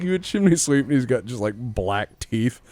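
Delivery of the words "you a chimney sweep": mock cockney. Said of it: "He's like".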